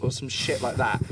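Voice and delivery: ordinary talk, nothing marked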